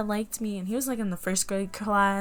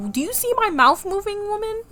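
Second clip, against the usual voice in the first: high-pitched, louder mock indignation; indignant retort; 12 semitones higher, 5 dB louder than usual. Pupil answering teacher who says she's silent, indignant retort. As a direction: squeal it back in exaggerated disbelief.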